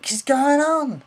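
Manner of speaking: high, loud startled cry; a sudden loud startled cry; startled outburst. Retold or imitated. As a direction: jump at the noise, high and loud. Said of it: "I thought".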